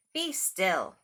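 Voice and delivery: loud firm command; commanding king. Acted. Max commands the wild things, loud and firm. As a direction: order the monsters to be still. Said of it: "Till Max said".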